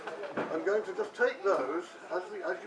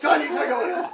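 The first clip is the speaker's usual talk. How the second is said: loud, high-pitched hammed-up Cantonese accent; comic Cantonese-accent chant, pitched up; mock Cantonese accent; 5 semitones higher, 9 dB louder than usual. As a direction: call it out in a pretend Cantonese accent. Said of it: "in your best Cantonese accent".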